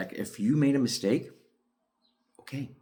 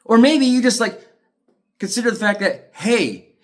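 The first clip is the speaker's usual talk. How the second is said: frustrated outburst; 9 semitones higher, 4 dB louder than usual. Real, not acted. Ranting at the court officials.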